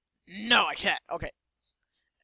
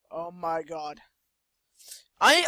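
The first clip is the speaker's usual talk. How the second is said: ordinary talk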